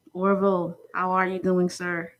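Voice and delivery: normal talking voice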